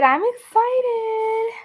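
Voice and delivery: high, slow gushing delight; answering her own question with giddy excitement; excited. Real, not acted. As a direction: stretch it out, way up high. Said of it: "Are you excited?".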